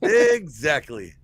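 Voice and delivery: slow, drawn-out EXACTLY; drawn-out emphasis. Real, not acted. Agreeing with the friend who said he's on time, drawn-out. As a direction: stretch EXACTLY out very slowly. Repeats word, second drawn out.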